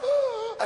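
badly faked gasping for air. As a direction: fake an asthma attack. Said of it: "try to fake everything".

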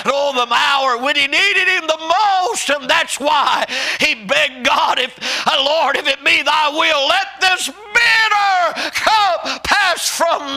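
high, loud whooping preacher cry; preaching at full force. Acted. Preacher pleading to the congregation, high full-force whoop. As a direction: lift it up and wail it out.

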